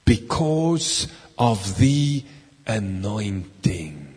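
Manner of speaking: slow, dropping to a hush on anointing; slow reveal fading low; slow dramatic answer. Shown as a demonstration. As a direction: draw it out and let anointing fall soft. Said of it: "How do you break the yoke".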